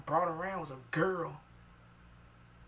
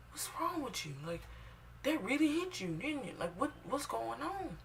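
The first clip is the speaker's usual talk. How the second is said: higher, concerned mom voice; lightly voiced worried mother; mother pressing her child gently, higher; mom asking; 10 semitones higher than usual. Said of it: "she was like".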